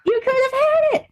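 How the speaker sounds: high-pitched teasing lament; mock reproach. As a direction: rub it in, high and incredulous. Mock-scolding the absent ex.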